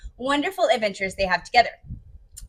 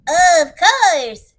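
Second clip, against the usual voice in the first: loud, higher, swooping hare voice; 4 semitones higher, 8 dB louder than usual. Acted. Hare answering Bear's thanks, loud character voice. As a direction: sing it out heartily. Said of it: "says Hare".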